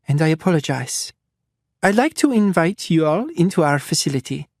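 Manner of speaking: polite doctor with a hint of a French lilt; slight French accent. Doctor apologizing to the captives, slight refined French accent. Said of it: "slightly French accent".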